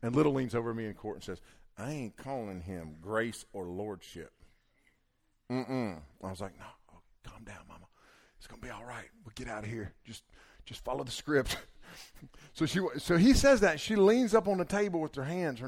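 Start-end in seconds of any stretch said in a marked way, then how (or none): 1.8-6.0 slow, softer, stubborn aside; muttered refusal. Retold or imitated. Wife refusing privately to husband in court, low stubborn aside. 6.6-11.6 very soft, low pleading; hushed calming. Retold or imitated. Husband calming wife in court, hushed urgent reassurance.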